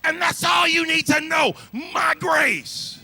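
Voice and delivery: loud, high, shouted sermon climax; full-force preaching. Acted. Preacher shouting God's promise of grace to congregation. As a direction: shout the grace message to the rafters.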